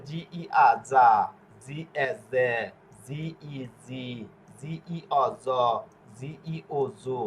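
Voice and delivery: each letter apart, ending in soft যা, জে, জি, জ, জু; soft G drill. Shown as a demonstration. Teacher drilling soft G, measured syllable recitation from যা to জু. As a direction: recite the spellings evenly, drawing out জে and জু with a soft j or z. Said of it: "জয়ের উচ্চারণ করবে".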